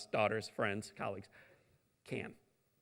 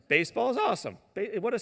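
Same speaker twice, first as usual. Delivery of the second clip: high, loud fan excitement; pumped-up baseball fan; excited; 9 semitones higher, 6 dB louder, faster than usual. Real, not acted. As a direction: burst out like a giddy fan.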